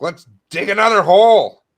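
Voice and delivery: high, loud eager digger voice; mocking voice. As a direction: play the gung-ho oil driller.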